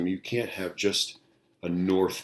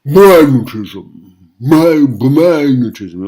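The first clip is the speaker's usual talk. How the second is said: loud, high, slow hammed-up British magnetism; mock British accent; 8 semitones higher, 21 dB louder, much slower than usual. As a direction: ham up a posh British magnetism, much louder and higher. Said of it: "if you listen to the British when they say it".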